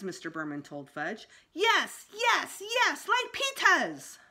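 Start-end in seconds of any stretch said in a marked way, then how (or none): 1.5-3.1 very high, loud insistent yes; toddler hollering. A narrator's reading. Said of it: "Fudge hollered". 3.1-4.2 high, slow, loud demand; drawn-out tantrum yell; toddler hollering. A narrator's reading. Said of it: "Fudge hollered".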